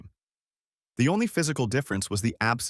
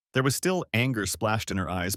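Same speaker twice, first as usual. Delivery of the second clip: usual speaking voice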